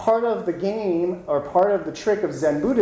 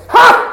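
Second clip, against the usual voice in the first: sudden loud, high yell; explosive shouted "Ha!"; 15 dB louder than usual. Acted. Zen master answering a student's question, explosive shout. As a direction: startle the student with one blast.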